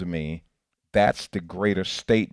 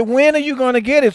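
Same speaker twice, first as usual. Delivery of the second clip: Jesus exasperated; 12 dB louder than usual. Retold or imitated. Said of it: "He said".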